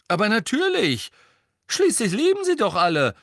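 high, loud, cross retort; angry protest. A narrator's reading. Fred insisting to Hermine that all survived, cross. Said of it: "sagte Fred erbost".

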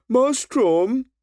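high, odd call; high character voice. A narrator's reading. Henry calls the coin toss.

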